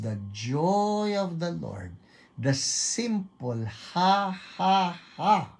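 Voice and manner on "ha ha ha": three high, separate ha's; deliberate forced laugh. Shown as a demonstration. Preacher showing the congregation the simple ha ha ha, deliberate forced laugh. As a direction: push out a laugh you don't feel. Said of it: "maski na hindi ka natatawa".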